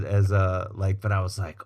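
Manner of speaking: ordinary talk, nothing marked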